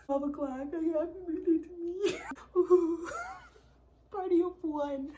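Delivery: high, loud laughing sob through "Happy birthday to me"; laugh-crying. Retold or imitated. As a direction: sing out your lonely birthday half crying. Laughing-crying mock celebration.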